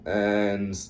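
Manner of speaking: usual speaking voice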